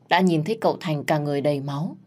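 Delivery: usual speaking voice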